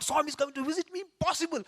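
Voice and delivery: put-on sick boy hearing Swami will visit, high and incredulous; stunned disbelief. Can't believe it, voice leaping up.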